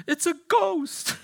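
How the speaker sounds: high, drawn-out yelp of terror; mock terrified cry. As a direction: act out a terrified shout, hammed up. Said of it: "they were terrified".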